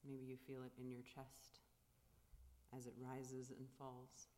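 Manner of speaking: calm, unhurried guiding of the breath; guided meditation. Acted. Leads a silent meditation.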